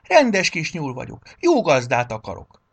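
high, loud plea; childlike, insistent declaration; stubborn. A narrator's reading. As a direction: insist like a stubborn little bunny. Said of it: "ismételte makacsól".

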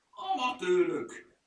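high, loud, drawn-out outburst; angry shout. A narrator's reading. Furmány berates the clumsy helpers, angry shout. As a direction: yell it in exasperation, stretching the word. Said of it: "kiabált mérgesen a furmány".

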